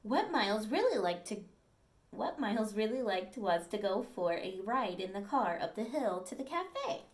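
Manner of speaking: storybook read-aloud, clear and lively; reading to children. Acted. Teacher reading a dog story to the class, lively storytelling. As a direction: read it to the class like a picture book.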